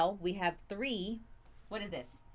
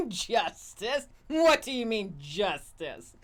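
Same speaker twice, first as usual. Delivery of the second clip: mock villain scoff; slower than usual. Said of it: "And then they go".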